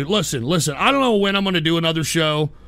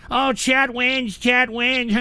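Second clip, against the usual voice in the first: slow, higher sing-song taunt; mock gloating; 5 semitones higher, slower than usual. Host reads a troll's victory message, mockingly.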